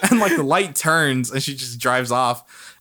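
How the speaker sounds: ordinary talk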